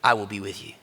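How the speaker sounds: deep, quiet divine promise; low, hushed voice of God. Acted. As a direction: answer calmly from above. God answers Moses again.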